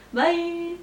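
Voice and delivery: normal talking voice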